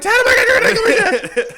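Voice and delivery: very high, loud laughing shriek; laughing as the drunk friend throws him out; laughing squeal. Retold or imitated.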